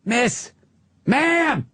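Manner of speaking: insistent rising call to a stranger. Retold or imitated. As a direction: call across the bar to get her attention. Patrice calling to a woman.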